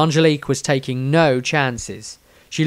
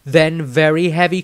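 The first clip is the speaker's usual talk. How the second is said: ordinary delivery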